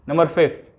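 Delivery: usual speaking voice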